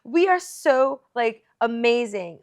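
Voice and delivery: loud, punchy cheerleading with stress on "are"; hyped-up pep talk. Real, not acted. Host rallying fellow Latinos, excited and loud. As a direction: pump everyone up like a rally.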